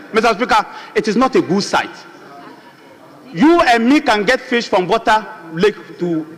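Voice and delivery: cool off and speak like a sober MP; calmed down. Real, not acted. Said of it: "Let me calm down and speak like an M P".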